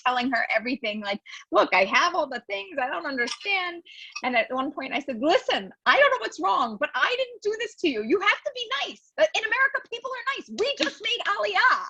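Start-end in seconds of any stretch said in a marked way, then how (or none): 1.5-11.8 high, upset pleading through tears; tearful pleading. Retold or imitated. Woman pleads tearfully with a rude office clerk. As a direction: retell begging the clerk, voice raised and near tears. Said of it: "And I'm crying".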